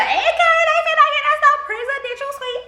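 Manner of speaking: very high, loud, laughing squeal of joy; excited squeal. Retold or imitated. Telling viewers about the upgrade, a high laughing squeal. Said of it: "I'm like".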